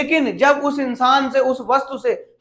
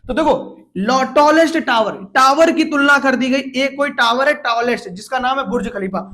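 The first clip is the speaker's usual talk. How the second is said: ordinary talk, nothing marked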